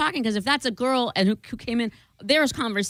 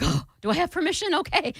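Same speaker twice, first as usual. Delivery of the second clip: mock surprise; 7 semitones higher than usual. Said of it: "I'm like".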